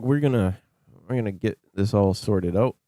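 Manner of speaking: normal talking voice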